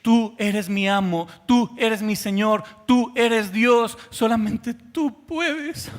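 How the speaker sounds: slow, soaring devotional cry; fervent cry. Acted. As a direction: cry out slowly in full devotion. Leper's confession to Jesus.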